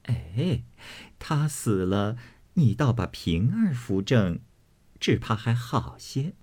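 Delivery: soft, sly coaxing with a touch of amusement; amused, coy suggestion. Acted. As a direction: suggest it coyly, keeping it hushed. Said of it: "那妇人笑道". (轻声笑着，娇媚地撺掇)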